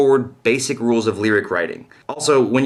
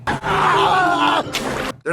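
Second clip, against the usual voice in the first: shout. Acted. Shouted dismissal.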